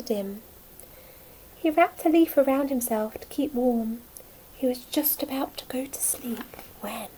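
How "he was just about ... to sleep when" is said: hushed suspense whisper. A narrator's reading. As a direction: whisper before the surprise. Bedtime suspense in story.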